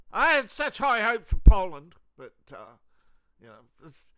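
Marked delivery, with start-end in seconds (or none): 0.1-2.0 loud, high disappointed whine; mock politician. European politician moaning about Poland, loud high whine. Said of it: "every politician in Europe is, you know".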